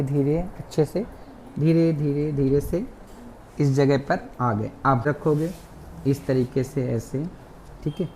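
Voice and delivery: ordinary delivery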